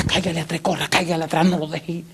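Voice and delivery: urgent gossip. Retold or imitated. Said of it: "Y me dice en la puerta".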